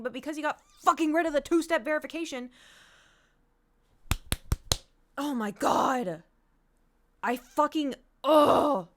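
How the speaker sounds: loud outburst breaking into a growled groan; angry exasperation. Real, not acted. Streamer locked out of account, angry and exasperated. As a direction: rant until words fail into a frustrated ugh. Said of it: "I'm so fucking angry".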